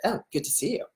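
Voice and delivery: soft, unenthused, sarcastic hello; snarky greeting. Shown as a demonstration. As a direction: toss off an unwelcoming greeting. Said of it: "just like how I did it".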